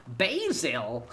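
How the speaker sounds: exclaimed mock-American "Basil", first vowel as "bay"; mock American accent. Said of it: "it's called basil, innit".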